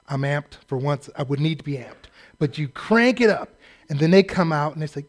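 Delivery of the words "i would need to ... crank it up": ordinary talk, nothing marked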